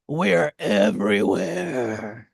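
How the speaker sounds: very low, drawn-out growl of a punchline; deep, slow, mock-sinister reveal; mock-ominous. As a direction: drop right down and stretch it out slowly. Drawn-out punchline after setup.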